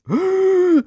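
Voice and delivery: loud, high, theatrical gasp; mock gasp. Host imitating offended actress, sharp high gasp. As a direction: gasp as if scandalised. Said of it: "Jane Fonda goes".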